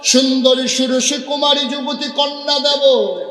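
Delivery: high, loud chanted offer; high preaching cry. Acted. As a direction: sing it out high and forceful, stretching the line ends. (চড়া সুরে জোরালো ওয়াজ)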